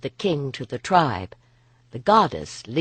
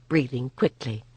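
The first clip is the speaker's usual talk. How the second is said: ordinary delivery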